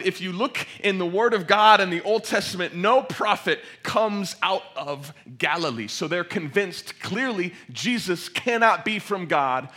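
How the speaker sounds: loud, high, building sermon delivery; full-force preaching. Real, not acted. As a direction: preach it with mounting urgency to the whole room.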